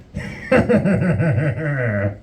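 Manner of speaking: long pulsing horror-villain cackle. Acted. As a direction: cackle slowly downward like a movie killer. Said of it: "Freddy Krueger par-, uh, popping out going".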